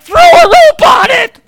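extremely high, loud, drawn-out scream; frantic retold yell. Friend under attack to roper, frantic yell. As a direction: scream the order as the desperate friend. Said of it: "And he's like".

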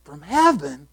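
a hint of worried priests conferring; scheming elders. Elders whispering together in a huddle, lightly voiced plotting. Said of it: "they say very plainly".

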